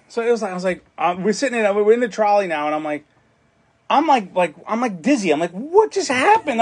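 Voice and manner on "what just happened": dazed disbelief. Retold or imitated. Stunned after being robbed.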